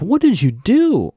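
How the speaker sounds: high, drawn-out mock dismay; shocked disbelief. Emily to Cece after the threatening call, mock appalled. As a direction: imitate an appalled friend, stretching it out.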